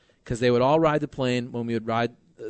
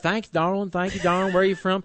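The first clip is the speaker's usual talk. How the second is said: put-on a light southern accent, higher; mock southern accent, a touch higher; 5 semitones higher than usual. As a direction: play a charming Texan owner in a southern accent. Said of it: "Is that your southern accent?".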